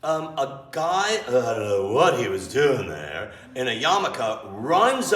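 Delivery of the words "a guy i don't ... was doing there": ordinary talk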